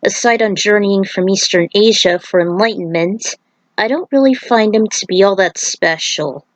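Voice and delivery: plain reading voice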